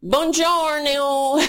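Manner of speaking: high, slow put-on accent mocking Melania; squeaky fake-European mockery of Melania. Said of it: "But she, you know".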